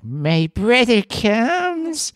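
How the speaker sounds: put-on high, slow eerie little girl; creepy child voice. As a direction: drag it out like a spooky child. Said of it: "her creepy child voice".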